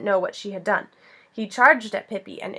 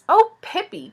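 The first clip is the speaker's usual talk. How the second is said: high, loud exclamation; Annika's excited cry; 9 semitones higher, 7 dB louder than usual. A narrator's reading. Annika to her friend Pippi, excited cry. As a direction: cry out excitedly, pitched well up. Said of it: "cried Annika excitedly".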